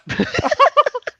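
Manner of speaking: short high laugh; high squeal of laughter. Real, not acted. Laughing at code joke.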